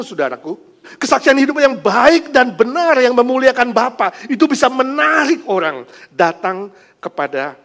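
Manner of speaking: loud fervent exhortation; fervent preaching. Acted. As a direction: preach it with conviction. Preaching application.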